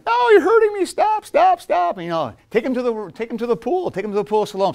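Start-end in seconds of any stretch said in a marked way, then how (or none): 0.0-2.0 high, loud cries of pain; anguished yell. Retold or imitated. Blind man screaming as mud smeared on eyes, anguished yell. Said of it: "the guy's yelling". 2.5-4.7 crowd calling out. Retold or imitated.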